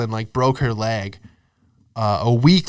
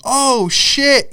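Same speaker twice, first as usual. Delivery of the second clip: high-pitched shocked reaction; shocked onlookers; 12 semitones higher, 4 dB louder than usual. Retold or imitated. Comic telling friends how onlookers reacted, shocked and high. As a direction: imitate a stunned crowd, pitched way up.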